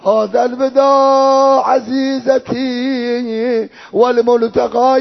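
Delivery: slow, sustained chanted mourning; farewell lament. Acted. Reciter voicing father's last farewell to daughter, mourning lament. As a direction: draw out each phrase in grieving recitation.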